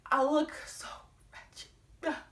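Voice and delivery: voiceless whisper; whispered dismay. Acted. Storyteller on how bad she looked, whispered. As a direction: drop to a whisper on the word. Mortified aside about her own look.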